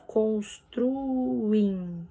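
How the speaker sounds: "Construim" drawn out, U and I apart; hiatus separated. Shown as a demonstration. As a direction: stretch "Construim" syllable by syllable. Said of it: "o I também são separados".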